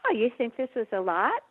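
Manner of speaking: high, fast, teasing retort; playful brag about overeating; joking comeback. Shown as a demonstration. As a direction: toss it back like a joke. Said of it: "it can be humor".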